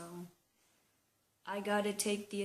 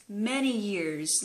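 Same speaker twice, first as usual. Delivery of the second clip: many slow and louder; stress on many years; 6 dB louder, slower than usual. Real, not acted. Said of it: "let me emphasize that".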